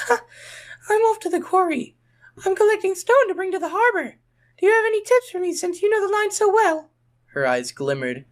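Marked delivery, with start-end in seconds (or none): 0.1-6.9 very high, sweet little engine; much higher, light Rosie voice. A narrator's reading. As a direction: voice a small girl engine.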